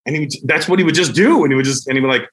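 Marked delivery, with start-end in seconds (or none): none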